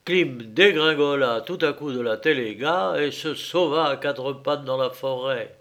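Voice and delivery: ordinary delivery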